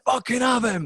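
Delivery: mock yell. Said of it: "I'm like, going".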